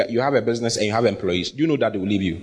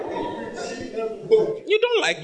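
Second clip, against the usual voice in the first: laughing; much slower than usual. Real, not acted. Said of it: "laughing drawn-out high line".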